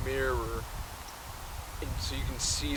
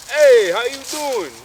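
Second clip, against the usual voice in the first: very high, loud, drawn-out greeting; booming hello pitched way up; polar bear, first attempt; 10 semitones higher, 12 dB louder than usual. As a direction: holler it slowly as an over-the-top polar bear. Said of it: "No, he didn't talk like that".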